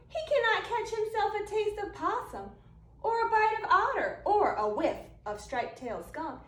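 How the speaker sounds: lively storytelling. A narrator's reading. Reading a picture book to kids, lively storytelling. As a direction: spin the tale to a room of children.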